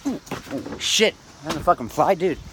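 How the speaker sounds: startled by a fly. Real, not acted. Speaker startled mid-monologue by a fly, real fright. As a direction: jump at a fly buzzing past, cursing. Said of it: "that shit scared me".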